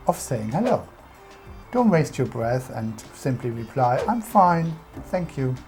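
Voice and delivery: ordinary talk, nothing marked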